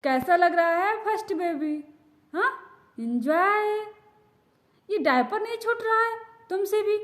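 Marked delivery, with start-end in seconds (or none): none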